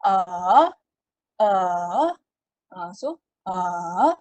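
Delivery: a dipping low then rising; third tone demo. Shown as a demonstration. Teacher demonstrating dipping tone three. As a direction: scoop a down and back up. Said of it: "kita macam slow kan suara kita".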